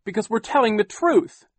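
high, loud outcry; cried out. A narrator's reading. Klaus crying out to Captain Sham. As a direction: insist desperately. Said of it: "Klaus cried".

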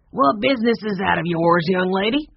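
put-on grumpy bookseller sharply rebuking a nosy girl. A narrator's reading. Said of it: "Mister Bindry said sharply".

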